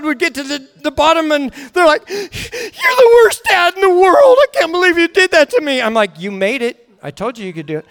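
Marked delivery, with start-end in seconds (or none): 2.1-5.8 put-on high, wailing child's accusation; mock tearful outrage. Child at the bottom blaming dad, tearfully outraged. 6.2-7.9 lower, softening reassurance; calm fatherly reply. Retold or imitated. Father pointing out child's success, calmly.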